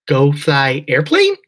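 put-on high, slow, loud childlike question; child asking. A narrator's reading. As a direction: ask like a small hopeful child. Little Penny asks hopefully.

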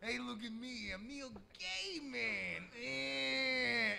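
slow, soft put-on character; lightly voiced joke persona, slowed down; Neil Gaiman character voice. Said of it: "He really only has two moves".

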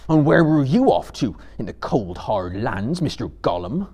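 loud, gruff challenge; growled. A narrator's reading. Sam confronts Gollum caught escaping, growling. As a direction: growl it accusingly at a captive. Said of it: "he growled".

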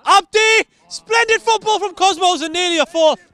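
high, loud thrilled call; excited commentary. Real, not acted. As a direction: lift the voice for the near miss. Near goal commentary.

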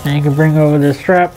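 muffled behind respirator; speaking through filter mask; through a face mask. Real, not acted.

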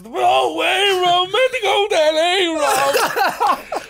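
high, loud, very slow wail, laughing and half crying; wailing drunk mate. Retold or imitated. Drunk friend lamenting missed flight, high slow laughing wail. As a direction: stretch it out like a drunk mate mourning his holiday.

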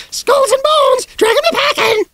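shrieking parrot squawk, very high and loud; parrot screech. A narrator's reading. The parrot screeching at the piano, a shrill squawk.

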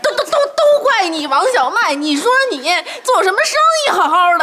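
laughing blame. Acted. Daughter blames Xiaomai, shouting.

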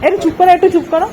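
put-on baby talk. Mother asking the baby what she eats, high baby talk. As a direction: chirp at the baby over her watermelon. Coaxing baby to sing, dance.

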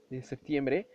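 ordinary talk, nothing marked

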